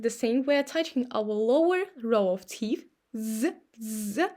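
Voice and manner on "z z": Z, z buzzed with the vocal cords on; voiced z demo. Shown as a demonstration. Teacher contrasting z with s, a voiced buzz. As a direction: hum a soft buzz through Z, z. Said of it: "a pair of, uh, z".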